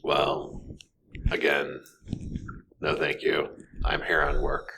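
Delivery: low, curt polite no. Acted. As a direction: shut her down calmly. Cat refuses again firmly.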